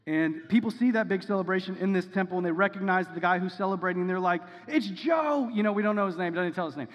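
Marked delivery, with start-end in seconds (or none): 4.7-5.5 much higher, louder call of surprise; excited recognition. Retold or imitated. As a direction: call out the name in amazed delight. Crowd recognizes healed beggar.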